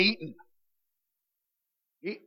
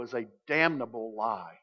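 quiet damnable; 9 semitones lower, 13 dB quieter than usual. Shown as a demonstration. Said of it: "Paul wants us to know".

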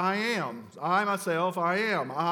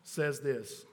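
normal talking voice

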